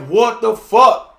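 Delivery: a drawn-out, alarmed exclamation; shocked outburst. Retold or imitated. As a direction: react to the sight of blood. Sees blood in the shower.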